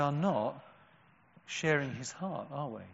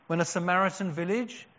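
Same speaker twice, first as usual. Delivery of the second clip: ordinary talk, nothing marked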